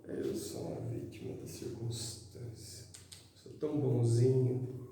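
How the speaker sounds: very soft little victim voice; mock self-pitying victim. Mocking those who feel like victims, soft self-pitying voice. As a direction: play the poor victim, barely voiced. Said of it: "Esse negócio de se sentir vítima".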